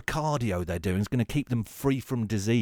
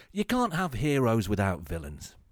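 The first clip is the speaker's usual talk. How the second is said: ordinary delivery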